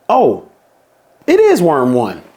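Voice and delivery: plain reading voice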